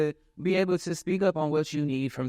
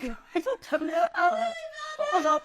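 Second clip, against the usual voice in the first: high squealing laugh; cracking up hard. Real, not acted. Laughing at her own fall.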